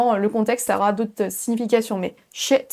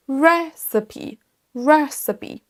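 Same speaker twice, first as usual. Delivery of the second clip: "Recipe" with RE punched, higher; stress on first syllable; 3 semitones higher, much slower than usual. Shown as a demonstration. Teacher showing the accented first syllable, stressed RESS in "Recipe". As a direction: hit RESS in "Recipe", light -uh-pee. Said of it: "ce mot est accentué sur la première syllabe".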